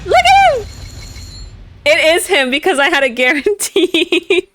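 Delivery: high, loud squeal of joy; excited squeal. Real, not acted. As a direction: shriek it with delight. Thrilled at pull.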